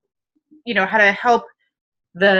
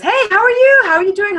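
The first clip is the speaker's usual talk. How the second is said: put-on a high, eager, louder hello to an old buddy; upbeat guy greeting; 12 semitones higher, 5 dB louder than usual. Guy in the elevator greeting his buddy, high and eager. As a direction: chirp out the greeting like a friendly coworker.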